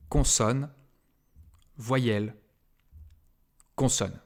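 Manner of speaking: consonne, voyelle, consonne, each word set apart by long pauses; spaced out pattern. Shown as a demonstration. Teacher spelling out a pattern slowly, each word set apart for the class. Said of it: "se termine par".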